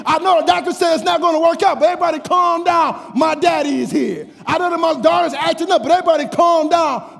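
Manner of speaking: high, loud sermon build; impassioned preaching. Real, not acted. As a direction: drive it home to the congregation at full voice. Preaches at full force.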